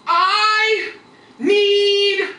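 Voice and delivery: slow, high, loud yell, word by word; drawn-out shout. Retold or imitated. Grandfather calls to his wife on the porch, slow yell. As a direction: stretch each word across the distance.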